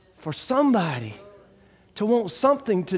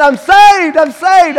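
much higher, much louder rejoicing; jubilant shout; 9 semitones higher, 12 dB louder, slower than usual. Retold or imitated. New convert running through the house, shouting his joy.

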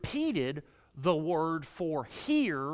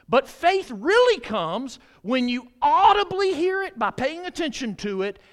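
preaching at full force; 7 semitones higher, 8 dB louder than usual. Real, not acted. Preaching climax.

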